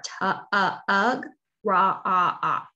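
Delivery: broken into sounds ending in ah ah; sounding out. Shown as a demonstration. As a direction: pull apart the vowel sound. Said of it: "tug of war".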